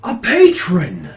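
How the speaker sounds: slow, loud, high-pitched proclamation; proclaiming priest. Acted. Priest presenting a patron to the crowd, loud and proclaiming. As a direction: proclaim it grandly to a whole crowd. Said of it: "And the priest says".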